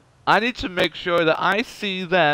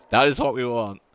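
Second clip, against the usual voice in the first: ordinary talk, nothing marked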